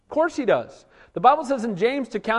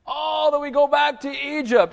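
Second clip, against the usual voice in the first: high mock whiny complaint; mocking whine; 8 semitones higher than usual. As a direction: mimic a whiny crowd. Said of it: "the group is whining again".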